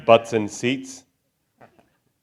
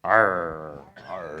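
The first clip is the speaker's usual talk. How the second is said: pirate arr; 7 semitones lower, 6 dB quieter than usual. Pretend. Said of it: "What would a pilot's, pirate say to celebrate?".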